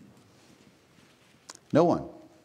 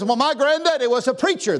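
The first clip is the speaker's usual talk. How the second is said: a high, louder mock voice of a smug excuse; mock excuse-maker; 12 semitones higher, 4 dB louder than usual. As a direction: play the self-assured doubter lightly. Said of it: "Somebody says".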